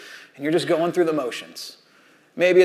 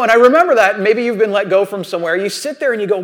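normal talking voice

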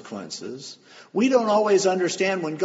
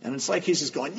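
ordinary delivery

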